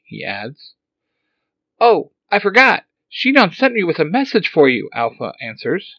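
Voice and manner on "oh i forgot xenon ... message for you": high loud robot blurt; robot remembering. Acted. Alpha Five suddenly remembering the message, high robot voice.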